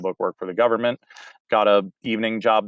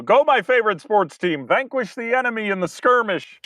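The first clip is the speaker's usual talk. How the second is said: high, mock-rousing sports chant through a laugh; lightly imitated comedian cheering, laughing; Brian Regan bit; 11 semitones higher than usual. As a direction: ham up a battle cry for a team. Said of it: "the comedian Brian Regan said".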